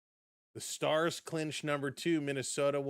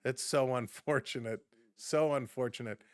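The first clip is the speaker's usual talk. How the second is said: usual speaking voice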